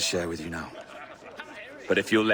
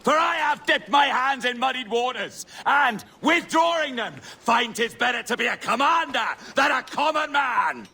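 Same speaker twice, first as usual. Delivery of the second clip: grand declamation. Acted. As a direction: proclaim it like a pirate king on stage. Roberts declaims to an audience.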